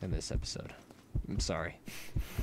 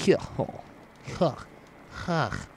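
evil ha ha; 5 semitones higher, slower than usual. Pretend. Joke Russian gamer practises his evil laugh, mock villain ha ha. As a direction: bark out each ha like a cartoon villain. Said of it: "So I can say".

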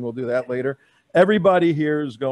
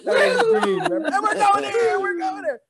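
high laughing mock yell. Laughing, still in the high Dean voice.

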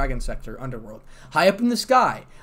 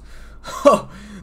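amused laugh; 11 semitones higher, 7 dB louder than usual. Real, not acted. Narrator laughs at Zeus joke.

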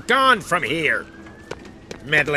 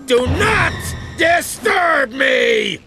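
high, drawn-out angry shout; furious bellow; 7 semitones higher, much slower than usual. Acted. Chief warns staff off his office, bellowing. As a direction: bellow each word as a warning.

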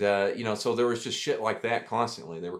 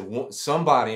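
retold yell; 3 semitones higher, 7 dB louder than usual. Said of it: "I just screamed at the top of my lungs".

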